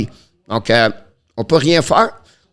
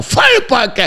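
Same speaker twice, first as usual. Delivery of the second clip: sudden high-pitched cry on the shot; excited shot call; 11 semitones higher than usual. Real, not acted. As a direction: burst out high as the shot is fired. Calls a shot in play-by-play.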